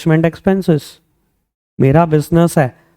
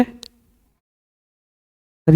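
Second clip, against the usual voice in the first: whispered plea; 9 dB quieter than usual. Pretend.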